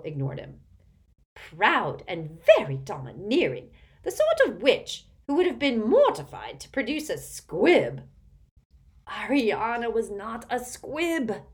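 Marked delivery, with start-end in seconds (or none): 1.3-8.1 loud, domineering Auntie Muriel character voice; imperious tipsy old aunt. A narrator's reading. Aunt greeting Harry at the table, imperious and loud. 9.1-11.4 high, drawn-out wheezy protest; wheezing. A narrator's reading. Doge defending Ariana to the aunt, wheezing. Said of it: "wheezed Doge".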